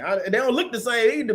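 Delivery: ordinary talk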